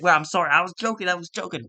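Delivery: hammed-up scared apology to Brock; mock panicked backpedal. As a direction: take it all back in a hurry. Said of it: "he's like".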